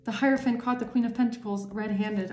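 usual speaking voice

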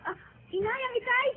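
high, tearful cry for help; panicked crying. Acted. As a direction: sob out the news in panic. Daughter finds father fallen. (takot at umiiyak na paghingi ng tulong)

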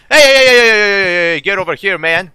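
loud repeated hey to catch its attention; loud insistent call. Real, not acted. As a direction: holler at him to get over here. Calling enemy to come over.

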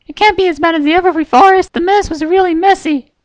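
very high, bright cartoon pony voice; high squeaky cheerful pony girl; Pinkie Pie voice. A narrator's reading. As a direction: chirp it like a giddy little pony.

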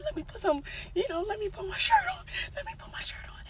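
high, frantic whisper; terrified hushed alarm; panicked whisper. Retold or imitated. Scared, whispering in car.

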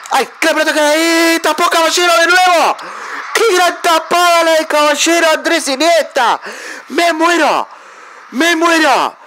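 can't believe the save, cry it out; excited disbelief. Real, not acted. Gamer thrilled by his keeper's second save, excited cries.

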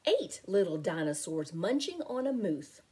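plain reading voice

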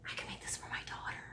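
secretive hushed whisper; real whisper hiding a gift plan. Real, not acted. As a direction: whisper so your daughter won't hear. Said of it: "Shh, she's here".

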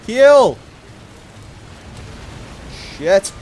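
panicked high shouting; being chased. Real, not acted. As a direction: yell in a frantic scramble. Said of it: "being chased".

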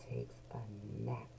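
unvoiced whisper for the nap; sleepy hush. A narrator's reading. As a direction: whisper so he stays asleep. Sleepy narration.

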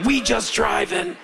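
imitating woman driver. Comic savoring the woman's zen reply, slow high imitation. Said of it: "she looks back and goes".

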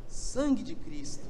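slow, soft disbelief; stunned mutter. Retold or imitated. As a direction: mutter in shock.

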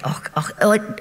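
airy whispered oh oh oh; voiceless whisper. Retold or imitated. Storyteller fussing at her over tea, whisper. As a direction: breathe the words with no voice. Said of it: "in that voice".

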